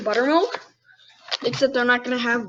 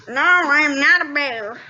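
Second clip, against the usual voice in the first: slow, loud indignant denial; a touch of wounded pride, drawn out; indignant skunk; 3 semitones higher, 6 dB louder, slower than usual. Acted. Skunk corrects the bunny, mildly indignant. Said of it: "said the creature indignantly".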